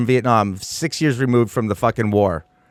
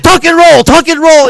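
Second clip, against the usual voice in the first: much higher and louder barked order; barked command; 13 semitones higher, 13 dB louder than usual. Retold or imitated. Driver pushing kids out the door, barked command. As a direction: shout it like a paratrooper instructor. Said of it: "He just opened the door and goes".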